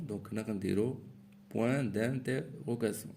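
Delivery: usual speaking voice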